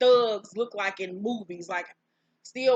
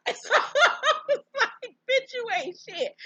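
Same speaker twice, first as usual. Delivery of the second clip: laughing squeal; 14 semitones higher, 4 dB louder, slower than usual. Real, not acted. Laughing at Lizzie's claim.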